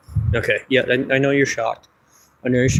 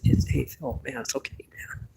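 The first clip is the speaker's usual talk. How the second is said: softer, almost whispered soothing; gently calm a hurt friend; hushed comforting; 10 dB quieter than usual. Acted. Comforting offended puppet.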